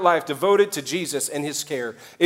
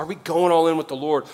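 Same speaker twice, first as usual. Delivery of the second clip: usual speaking voice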